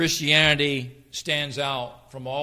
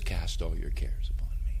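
lower, tender whisper as God; intimate whisper; 8 semitones lower, 4 dB quieter than usual. Acted. Preacher voicing God's invitation: intimate whisper. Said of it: "with intimate love, he says".

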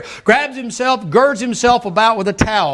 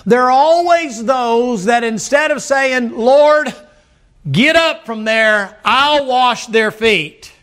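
slow, deliberate emphasis; 4 semitones higher, 3 dB louder, much slower than usual. Acted. Preacher slows to make the point.

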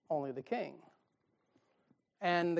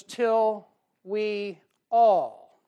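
drawn out; 4 semitones higher, 8 dB louder, much slower than usual. Acted. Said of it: "reading scripture slowly".

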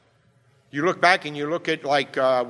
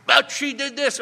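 high, put-on kid's protest; mock child whining; whiny child; 10 semitones higher, 3 dB louder than usual. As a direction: whine it like a caught kid.

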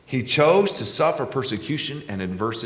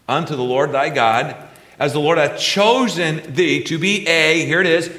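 high, loud fervent preacher pressing each phrase; full-force preaching; 8 semitones higher, 7 dB louder than usual. Acted. Pastor rallying the congregation behind Israel, in a loud fervent preach. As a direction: preach it out, rising with fervour.

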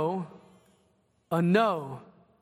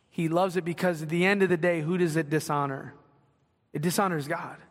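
ordinary talk

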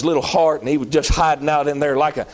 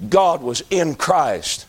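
ordinary talk, nothing marked

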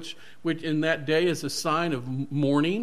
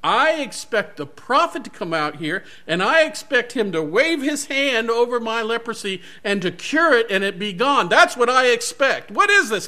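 higher, louder indignant commander ranting; angry; 7 semitones higher, 6 dB louder than usual. Acted. Proud commander raging at being snubbed by the prophet, higher and louder. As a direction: rage with wounded pride, climbing in pitch. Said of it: "pride busting from him".

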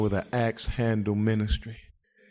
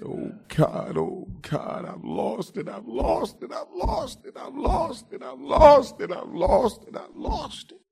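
breathy pleading that climbs higher with each I've lost it; mock crying prayer; 13 semitones higher than usual. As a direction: plead like a broken man finding his loss. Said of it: "Some of us just need to start crying".

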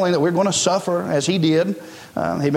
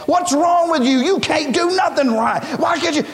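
yelling boss; 8 semitones higher than usual. Retold or imitated. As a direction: play the furious boss berating an employee. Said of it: "to that boss said".

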